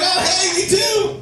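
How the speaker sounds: high excited yelp of agreement; delighted shout. Retold or imitated. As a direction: cheer it like you found a kindred spirit. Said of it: "I'm like".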